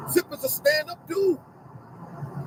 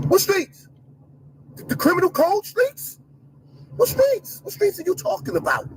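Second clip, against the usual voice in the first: incredulous challenge; 6 semitones higher than usual. Real, not acted. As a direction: hammer the question with disbelief. Mocking challenge, repeated.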